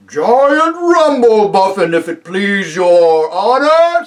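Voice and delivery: high, booming, drawn-out giant voice; deferential giant. Acted. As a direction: boom it out slowly, respectfully. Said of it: "said the giant".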